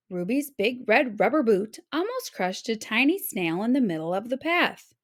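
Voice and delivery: plain reading voice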